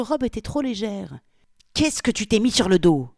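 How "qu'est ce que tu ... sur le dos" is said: loud, startled outburst; sudden exclamation. Acted. Polly discovers the wrong dress, exclaims loudly. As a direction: blurt it out loud and sharp. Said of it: "s'exclama-t-il soudain".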